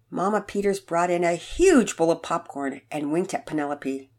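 ordinary delivery, nothing marked

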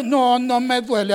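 slow, drawn-out acted denial; mildly strained, stubborn insistence. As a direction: insist slowly that all is fine. Acted denial of obvious pain.

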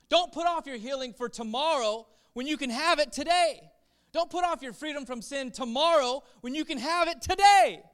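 fiery pulpit appeal; full-force preaching. Acted. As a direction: urge the congregation not to wait.

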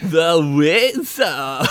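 slow, high, put-on weasel voice; sly hissing weasel. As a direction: stretch it out like Pauly Shore's weasel. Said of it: "That's even better".

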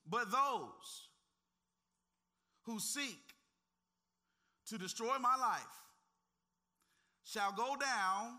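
slow reading with long pauses between phrases; read slowly. Shown as a demonstration. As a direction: lay each phrase down one at a time. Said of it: "we're gonna read this real slowly".

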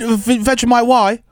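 high, slow, stammered whine; stuttering mock plea. Mocking her sad call, high stammering whine. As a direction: drag it out, stammering. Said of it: "she went straight into".

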